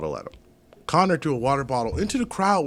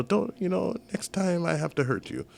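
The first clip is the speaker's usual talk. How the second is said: put-on Russian accent; 5 semitones higher, 4 dB quieter than usual. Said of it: "that was a pretty good Russian accent".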